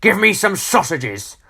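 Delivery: bad-tempered storybook customer; snapped. A narrator's reading. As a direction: snap out a rude order. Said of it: "snapped Mister Grumpy".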